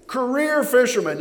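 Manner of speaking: usual speaking voice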